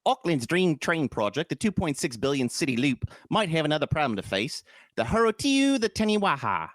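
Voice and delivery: mock New Zealand newsreading; New Zealand accent. Host reading a baffling article, put-on New Zealand accent. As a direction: read it like a Kiwi, Australian up in the nose. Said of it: "my New Zealand is just my Australian".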